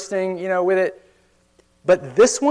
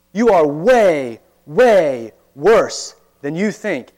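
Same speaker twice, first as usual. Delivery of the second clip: slow, louder, leaning hard on "way, way"; emphatic warning; 4 dB louder, much slower than usual. Acted. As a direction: press each word home, slowly. Preacher confronting the congregation.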